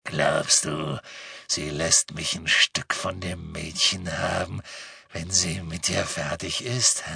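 low, slow creepy croon; deep leering villain coo; cooing menace. A narrator's reading. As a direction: coo it low like a savouring predator. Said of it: "gurrte Greyback".

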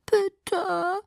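high, soft, drawn-out plea; wheedling entreaty; begging. A narrator's reading. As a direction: beg softly in a high little voice. Alice begging again.

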